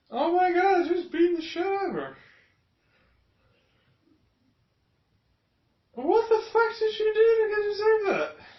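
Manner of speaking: high, loud, near-crying yell; real anguished high yell; outraged shout. Real, not acted. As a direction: shout at the screen in real disbelief. Outraged at the beating.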